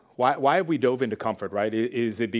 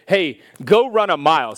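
loud, raised command; acted challenge; 4 semitones higher, 9 dB louder than usual. Preacher challenges listeners to run a mile, loud command. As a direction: call it out like a mock drill order. Voices giving a physical challenge.

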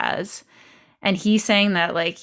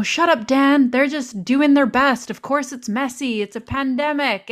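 mock-exasperated telling-off, pitched up; retold anger; 6 semitones higher than usual. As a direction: act out snapping at your husband, moderately. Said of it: "I would get so angry at him".

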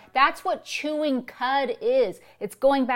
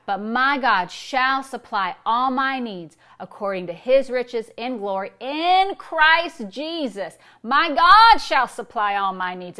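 yelled declaration; 3 semitones higher, 7 dB louder, slower than usual. Shown as a demonstration. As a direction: belt the verse out, stretching IN and GOD. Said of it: "You're not yelling it".

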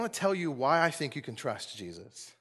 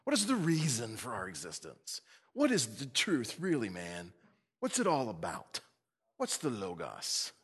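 lightly voiced hookah-smoking musing; stoned philosopher; 4 semitones lower, 4 dB quieter than usual. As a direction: drift through the questions like a stoned philosopher. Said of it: "smoke a hookah and they'd say".

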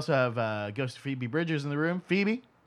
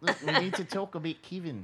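higher, laughing mock-Australian; giggly Australian impression; bad Australian accent; 5 semitones higher than usual. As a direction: do a broad fake Aussie accent. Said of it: "really bad Australian accents".

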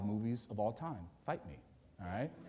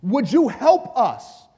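high, forceful plea to God; fervent petition in a raised preaching voice; impassioned preached prayer; 8 semitones higher, 10 dB louder than usual. Acted. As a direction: plead it from the pulpit, drawing out key words.

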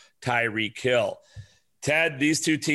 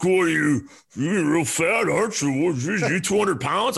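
higher, louder mock jab at his weight; mocking brother; 7 semitones higher, 4 dB louder than usual. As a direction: tease him like a rude older brother. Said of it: "he's like".

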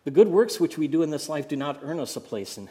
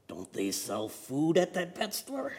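slow, feeble dying parrot; weak voice; 5 semitones higher than usual. Acted. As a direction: rasp it out faintly and slowly. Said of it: "he said in a very weak voice".

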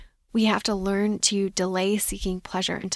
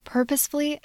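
Purposefully said much higher, leaning hard on it; stress on purposefully; 7 semitones higher than usual. Shown as a demonstration. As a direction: hit the word Purposefully up high and deliberate. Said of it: "I emphasize the word purposefully".

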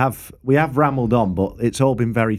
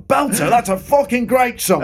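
mock drunken cheer, pitched high; high, hollered impression of an excited pub punter; imitating rowdy punter; 11 semitones higher than usual. Drunk punter reacting to the jukebox, high mock cheer.

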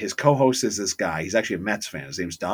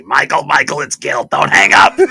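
loud pleading caller voice; frantic caller; 5 semitones higher, 13 dB louder than usual. Retold or imitated. Caller begging the radio host not to hang up, loud and frantic. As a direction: beg him not to hang up. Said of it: "first he says to Michael Kay".

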